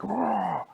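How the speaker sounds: high, loud playful roar; hammed-up monster roar; mock roar. Startled man roars.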